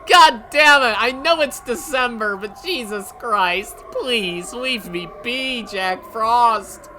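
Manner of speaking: loud, high-pitched cursing at the weather; explode, then plead slowly and curse; exasperated rant. Real, not acted. Gamer ranting at sudden snow.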